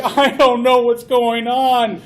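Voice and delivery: loud, slow, baffled complaint; mock bewilderment. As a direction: sound completely lost. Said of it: "And you're like".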